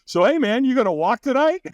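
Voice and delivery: high, offhand invitation; imitating a breezy coach; casual trainer. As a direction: slide the question in casually. Trainer asks if he'll firewalk.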